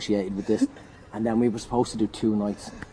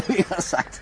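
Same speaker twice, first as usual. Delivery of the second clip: high, laughing punchline; real laughter, high and loud; 11 semitones higher, 6 dB louder, slower than usual. Real, not acted. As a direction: giggle the punchline out.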